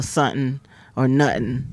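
"something or nothing" as "sut'n or nut'n"; cut-off pronunciation. Shown as a demonstration. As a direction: swallow the middles of "something" and "nothing". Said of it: "the words get cut off".

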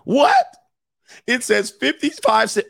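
a sudden high squeal of disbelief; a customer's indignant high cry at the register; outraged what. Retold or imitated. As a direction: shriek it in shock. Mock outraged customer reaction.

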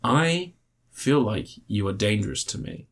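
a lightly voiced woman choosing words with care; clever halting woman. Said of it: "in a very clever way".

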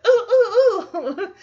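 high, loud oohing; hammed-up eager classroom oohs, laughing; imitating eager pupil. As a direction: beg for the teacher's attention with your hand up. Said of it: "how she'd get the attention of a teacher".

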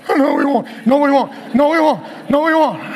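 loud, high, frantic repeated protest; hammed-up panicky wail, far above normal pitch; mock panic. Acts out panicking at wife's calm.

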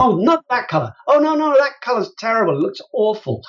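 a hint of a shop assistant waving off a bad colour; fussy shop assistant. Shop assistant voiced in story.

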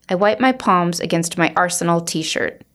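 usual speaking voice

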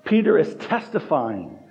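ordinary talk

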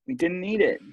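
high-pitched, emphatic complaint; emphatic lament. Real, not acted. Protesting the murder hornets to a friend, high and emphatic.

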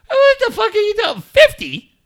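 loud, high-pitched incredulous outburst; high, loud mock scolding; mock outrage. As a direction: chew him out in comic disbelief. Acted scolding of his son.

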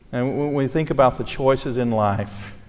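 normal talking voice

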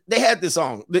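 ordinary talk

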